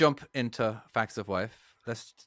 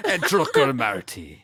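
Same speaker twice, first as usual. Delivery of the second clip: put-on part Russian part German accent; 4 dB louder, slower than usual. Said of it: "part Russian, German".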